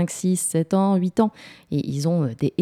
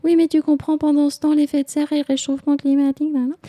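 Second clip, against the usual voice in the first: high monotone parental rant; nagging lecture; 11 semitones higher than usual. Acted. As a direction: drone on in a high mock-nagging voice. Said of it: "un long charabia".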